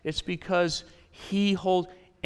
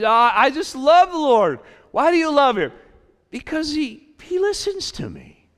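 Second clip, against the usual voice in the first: high and loud, then higher and lighter; psalmist's voice; 7 semitones higher, 7 dB louder than usual. Acted. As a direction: voice the psalmist, climbing up in pitch.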